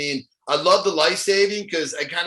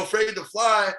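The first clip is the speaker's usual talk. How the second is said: ordinary talk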